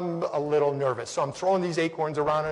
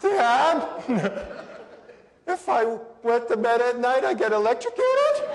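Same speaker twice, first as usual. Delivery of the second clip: put-on much higher, giggly kid asking his dad; little boy voice; 7 semitones higher, 3 dB louder than usual. Young son asks dad about the alarm, childlike high voice. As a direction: play the scared little son, pitch way up.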